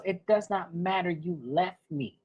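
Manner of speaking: a hint of the wounded daughter; hurt daughter. Daughter accusing mother.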